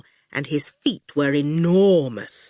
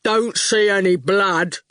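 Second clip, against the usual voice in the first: a hint of a strong, dim-witted goon; strong daft voice. A narrator's reading. Goon answers the little girl, strong and daft. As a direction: say it dim and sturdy. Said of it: "He spoke in a strong, daft voice".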